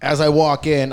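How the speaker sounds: usual speaking voice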